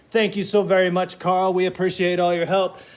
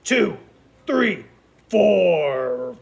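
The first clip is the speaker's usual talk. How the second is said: crocodile chomps; 4 dB louder, much slower than usual. Pretend. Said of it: "he's gonna give you a one".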